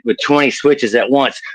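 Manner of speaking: ordinary talk, nothing marked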